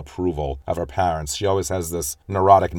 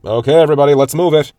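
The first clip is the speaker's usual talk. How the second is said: higher, louder rallying shout; yelling; 7 semitones higher, 9 dB louder, faster than usual. Retold or imitated. Father rounding up the family, loud shouting. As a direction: bark the family into motion. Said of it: "he simply yells".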